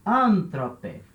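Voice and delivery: Anthrope with a strong, stretched AN; ANthrope, accent three syllables from the end; stress on first syllable. Shown as a demonstration. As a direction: punch AN in Anthrope. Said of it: "o acento é na terceira sílaba".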